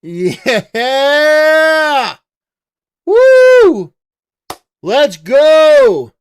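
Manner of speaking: loud whooping cheer, pitch leaping high; yeah, whoo, let's go, all shouted. Real, not acted. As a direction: cheer like the home team scored. Cheering deportation flight numbers.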